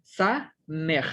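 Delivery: "Sa, mej" read as two separate syllables; split into syllables. Shown as a demonstration. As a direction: break "Sa" and "mej" apart, the shva silent. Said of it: "tal como es en este caso".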